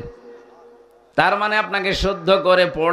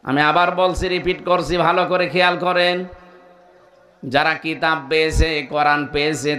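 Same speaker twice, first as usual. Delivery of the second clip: normal talking voice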